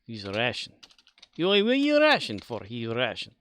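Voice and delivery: playful mock Russian accent; joking Russian-accented delivery. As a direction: put on a hammed-up Russian accent. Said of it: "Do it with the Russian accent".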